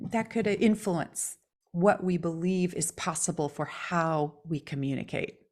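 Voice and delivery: normal talking voice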